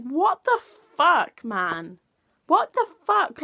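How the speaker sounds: loud, incredulous curse; outraged disbelief. Real, not acted. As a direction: spit it out in disbelief. Outrage at expenses news.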